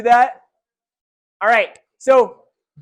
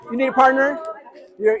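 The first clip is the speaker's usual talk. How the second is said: usual speaking voice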